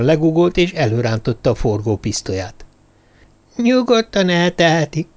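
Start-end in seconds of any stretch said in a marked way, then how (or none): 3.6-5.1 a higher, squeaky little creature voice from the dark. Acted. The hidden stranger greets Jervis from the darkness, squeaky and high. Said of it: "egy nyekergő hangot".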